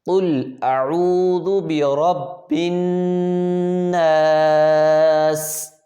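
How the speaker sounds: slow melodic tajweed chanting; Quran recitation. Shown as a demonstration. Teacher reciting a verse for students to repeat, melodic. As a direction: recite it slowly in a chanting tune.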